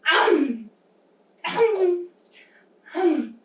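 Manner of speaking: three separate pretend bites; big chomps. Teacher taking three bites with children, playful exaggerated chomping. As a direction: chomp heartily, one bite at a time. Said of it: "we're gonna take three bites".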